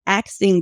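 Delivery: "asking" pronounced "aks-ing"; "asking" as "axing". Retold or imitated. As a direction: swap the s and k in "asking" to get "axing". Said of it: "not asking them".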